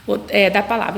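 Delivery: ordinary talk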